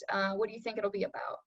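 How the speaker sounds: normal talking voice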